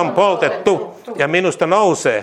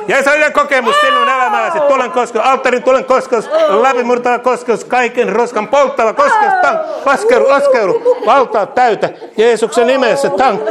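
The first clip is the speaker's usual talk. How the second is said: ecstatic preaching; 5 semitones higher than usual. Acted. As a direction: pour fire over him with rising, laughing fervour. Ecstatic prayer over believer.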